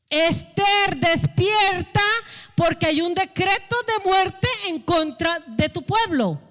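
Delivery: urgent wake-up call. Retold or imitated. Mardoqueo urging Esther to wake for her people, urgent calling out. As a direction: shake her awake with your voice.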